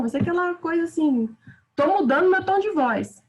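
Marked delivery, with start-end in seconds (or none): 1.7-3.2 raised, lively exclamation voice; exclamatory tone. Shown as a demonstration. Teacher demos exclamation intonation to students, pitched up. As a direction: show how an exclamation lifts the tone. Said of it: "a exclamação sempre vai ter aquela entonação".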